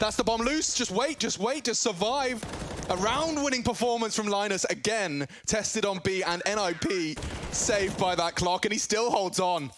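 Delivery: excited casting. Real, not acted. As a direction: call the clutch with rising excitement. Caster hyping clutch round.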